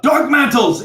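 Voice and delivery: high, loud alarm cry; urgent full-voiced shout; yelled warning. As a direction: yell out a warning to the camp. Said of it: "he yells out".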